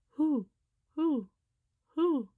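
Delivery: high, loud repeated hooting; owl hoots. Acted. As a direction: hoot each one like an owl. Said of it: "the owl asked".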